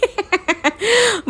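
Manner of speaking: high laugh tangled with a coughing fit. Real, not acted. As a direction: laugh while choking on a cough.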